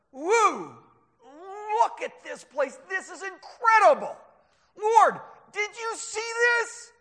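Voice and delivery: high, loud, wide-eyed amazement; astounded. Acted. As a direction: whoop like an awestruck sightseer. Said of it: "They were astounded by it".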